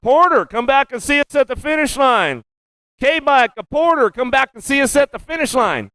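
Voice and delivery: high, carrying call over the PA. Real, not acted. Announcer calls the Porter rider back to the finish line. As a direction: summon the rider back across the track.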